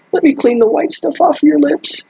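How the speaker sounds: mock-tearful high falsetto; tearful Mabel. A narrator's reading. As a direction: put on a squeaky, weepy girl's voice. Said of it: "Mabel said through her tears".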